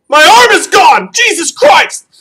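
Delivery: very high, loud slow scream; mock agonized shout. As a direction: yell in hammed-up horror over a lost limb. Acts out a gag injury.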